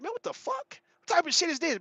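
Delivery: a quiet, high gasp of disbelief; shocked disbelief. Retold or imitated. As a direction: react like you can't believe what you just saw. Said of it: "I was like".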